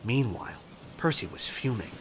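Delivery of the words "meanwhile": usual speaking voice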